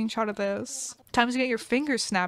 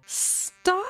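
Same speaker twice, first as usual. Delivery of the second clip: emotional outburst; 7 semitones higher than usual. Real, not acted. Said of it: "I'm emotional".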